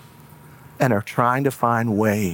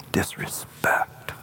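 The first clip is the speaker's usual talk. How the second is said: hushed, bitter whisper of disrespect; whispered seethe; 8 dB quieter, slower than usual. Acted. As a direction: breathe the word like an offended parent. Parent seething at boundary.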